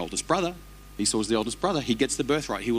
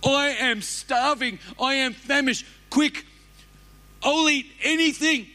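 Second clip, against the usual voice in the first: desperate starving voice; 13 semitones higher, 3 dB louder than usual. Acted. Preacher showing the congregation how a starved person sounds, desperate and high. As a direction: cry out like you'd eat anything. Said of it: "those choices sound like this".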